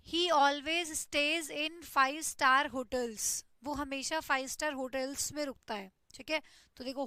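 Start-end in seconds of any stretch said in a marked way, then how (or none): 0.1-3.4 very slow and a bit louder; slow, clear teacher dictation. Shown as a demonstration. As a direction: announce the new example slowly.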